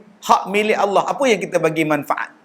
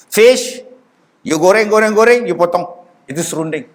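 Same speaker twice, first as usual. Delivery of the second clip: broken English explanation. Retold or imitated. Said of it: "Dia tanya pula".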